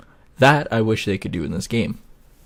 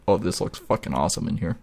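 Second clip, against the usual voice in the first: ordinary delivery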